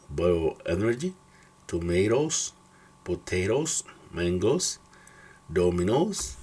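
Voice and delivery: "toe", "goes", "mangoes" with a long English O; English OE and O words. Shown as a demonstration. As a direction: model "poem", "bioenergy", "tomatoes" one by one. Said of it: "Por ejemplo".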